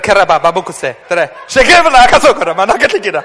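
loud fast tongues; speaking in tongues. Real, not acted. Preacher breaking into tongues, loud and fast. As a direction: pour out a burst of tongues.

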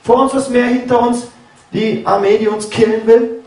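high, frantic plea in panic; desperate Moses pleading high; panicked cry to God. Retold or imitated. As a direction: cry out to God, cornered between the sea and the army. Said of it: "schreit auch zu Gott und sagt".

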